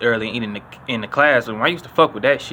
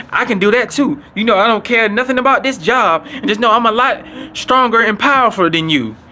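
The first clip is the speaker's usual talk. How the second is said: higher mocking voice of a tough teacher; teacher squaring up; 7 semitones higher than usual. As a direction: play the teacher bragging he is stronger, voice pitched up.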